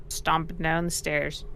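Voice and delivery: normal talking voice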